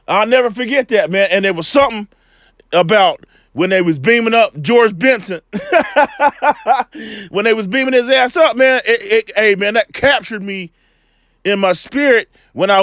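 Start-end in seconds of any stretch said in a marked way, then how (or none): 5.5-7.2 long, high chuckling laugh; amused laugh. Real, not acted. Man recalling a childhood show, laughing heartily. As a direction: burst into a run of laughter.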